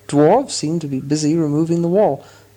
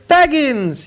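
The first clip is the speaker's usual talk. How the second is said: high, loud glad cry; delighted exclamation; 12 semitones higher, 4 dB louder than usual. A narrator's reading. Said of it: "he exclaimed".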